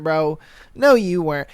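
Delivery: ordinary talk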